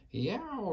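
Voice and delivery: a sudden high yowl of pain in sleep; high giant yowl. A narrator's reading. Sleeping giant yowls at nightmare, high pained yowl. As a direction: yelp it high, like a stung giant. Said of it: "roared the flesh lump eater".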